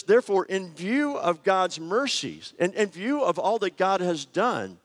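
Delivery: lightly heightened scripture quote; scripture reading. Shown as a demonstration. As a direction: read the Scripture with weight. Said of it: "he says".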